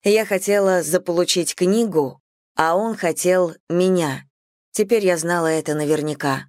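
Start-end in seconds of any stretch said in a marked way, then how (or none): none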